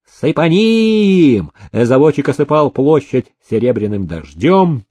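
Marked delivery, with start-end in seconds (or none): 0.1-1.5 very high, drawn-out cry; gleeful high-pitched whoop of command; exultant. A narrator's reading. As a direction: cheer him on, stretching the calls. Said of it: "Никита Никитич ликовал".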